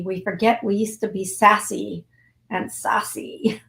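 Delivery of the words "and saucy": slow, teasing breathy aside; playful whisper. Real, not acted. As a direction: whisper it like a naughty secret.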